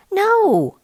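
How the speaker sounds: put-on blunt child reply; kid voice. A narrator's reading. Kid answers.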